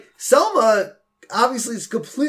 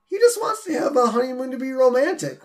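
lightly put-on, higher-pitched voice; Marge retold; 6 semitones higher than usual. Podcaster quoting Marge explaining the honeymoon, lightly higher voice. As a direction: raise the pitch a little for the quoted wife. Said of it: "And Marge's like".

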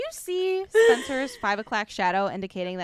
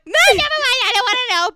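high, loud panicked refusal; shrill squeal; 12 semitones higher, 10 dB louder, faster than usual. Retold or imitated. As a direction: squeal it out, horrified. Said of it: "Carly's literally like".

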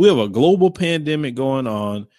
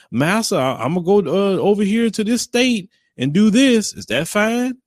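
higher, pleading servile voice asking permission; mock servile slave; 8 semitones higher than usual. Mocking those who report to master, higher servile pleading. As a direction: ham up a slave begging master's leave, pitched higher. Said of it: "Only slaves go out there and broadcast to their master".

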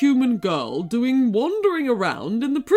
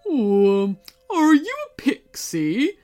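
slow-starting, louder character voice with tired hesitation; world-weary creature, puzzled question; weary griffin; 5 semitones higher, 5 dB louder than usual. A narrator's reading. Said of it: "he asked wearily".

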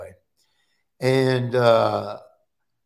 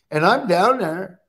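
usual speaking voice

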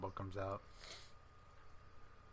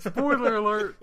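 sarcastic spoiler alert; 10 semitones higher, 9 dB louder, slower than usual. Real, not acted. Sarcastic reply to obvious spoiler.

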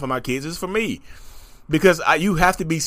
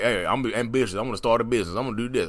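quoted striver; 6 semitones lower, 6 dB quieter, faster than usual. Retold or imitated. Quotes an eager would-be entrepreneur.

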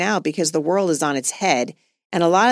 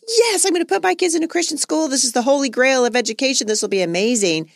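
much higher, gushing mock enthusiasm; mock excited; 7 semitones higher than usual. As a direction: play up her old giddy excitement about the school. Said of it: "And at first I was like".

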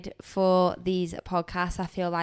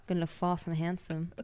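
put-on copied Aussie accent; 2 semitones lower, faster than usual. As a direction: echo his Aussie line back, half-hearted. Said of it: "that was actually quite a good accent".

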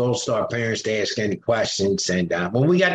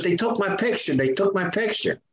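higher, alarmed repeated cry; mimicked panic; 6 semitones higher than usual. Wife panicking as her photo appears, higher alarmed voice. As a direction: voice the wife's frightened outburst.

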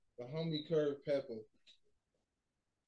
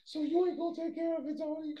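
woman's voice; 13 semitones higher than usual. Retold or imitated. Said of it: "she's like".

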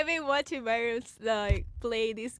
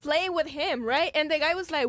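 ordinary talk, nothing marked

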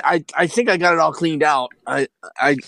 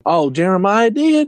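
lightly voiced, higher mom, pleasantly surprised; a hint of a doting mother, pitched up; sweet mom; 6 semitones higher than usual. Mom hears her son asked for dinner, sweetly surprised.

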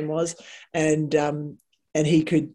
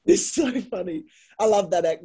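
ordinary delivery

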